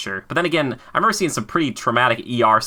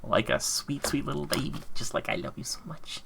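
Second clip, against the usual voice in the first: put-on whispered, much softer baby talk; mock-tender baby whisper; cooing to a baby; 4 semitones higher, 9 dB quieter than usual. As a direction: coo it like cradling a newborn. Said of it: "cooing to game like baby".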